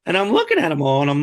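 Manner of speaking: ordinary talk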